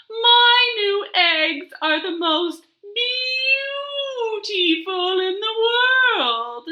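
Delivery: high, slow, loud boastful hen; vain hen drawl. Acted. Zinnia the vain hen boasting about her eggs, slow high drawl. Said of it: "she said with a long, slow drawl".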